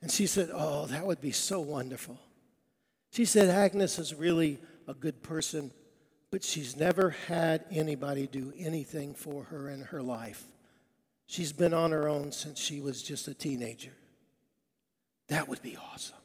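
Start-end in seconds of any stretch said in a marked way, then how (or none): 0.5-2.2 soft, lower, touched reply; mild tearful wonder, kept quiet; tearfully moved. Retold or imitated. Harry's wife moved by the party plan, softly tearful. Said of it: "got tears in her eyes". 3.5-16.2 low, soft, tender retelling; quietly touched, sinking softer; tearfully moved. Retold or imitated. Wife speaking kindly of lonely Agnes, softly tearful. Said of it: "got tears in her eyes".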